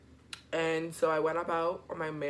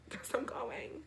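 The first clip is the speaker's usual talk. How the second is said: gloating whisper; 6 semitones higher, 8 dB quieter, slower than usual. Retold or imitated. Kid gloating after laugh.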